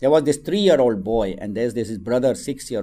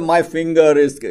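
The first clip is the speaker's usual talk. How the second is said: put-on higher, loud tearful little boy; crying child; 4 semitones higher, 8 dB louder than usual. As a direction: blurt it out through tears. Child sobbing to mother.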